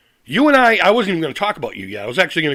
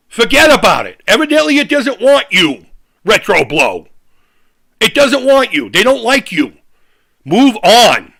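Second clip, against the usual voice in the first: louder and higher, slow on the jabs; angry shouting; 3 semitones higher, 7 dB louder than usual. Real, not acted. Telling Retro Blow his crew doesn't want him, angry shouting. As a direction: yell the brush-off at him.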